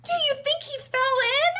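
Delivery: high, loud hammed-up wail; storybook panic; panicked. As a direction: fret loudly at the top of the voice. Friends panic that Max fell in.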